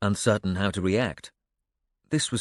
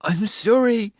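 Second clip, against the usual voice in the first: put-on high, loud tearful child character; hoarse, tearful apology; 13 semitones higher, 5 dB louder, slower than usual. A narrator's reading. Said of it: "he said, his voice hoarse".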